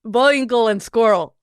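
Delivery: cartoon Russian villain accent; practised fake Russian accent; Natasha. Shown as a demonstration. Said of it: "it's like Natasha from Bowinkle".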